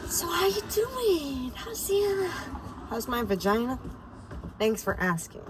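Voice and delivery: high sing-song coworker voice; coworkers asking. Pretend. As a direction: play an overly sweet coworker. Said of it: "everybody at work is gonna be like".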